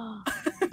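sharp dramatic intake of breath; mock shocked gasp. Singer realizing everyone is watching, mock horrified gasp. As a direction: gasp as if caught out.

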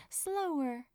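ordinary delivery

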